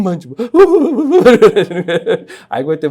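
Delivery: real laughter while telling the story; laughing. Real, not acted. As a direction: laugh through the words.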